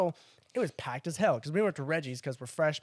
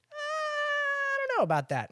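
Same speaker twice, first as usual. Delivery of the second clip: high, drawn-out mom voice, imitated; hesitant mom; slower than usual. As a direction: stretch it out high like a doubtful mom. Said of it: "And she said".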